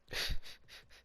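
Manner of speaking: short breath of laughter; amused laugh. Real, not acted. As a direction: let out a brief voiceless laugh. Amused by the joke.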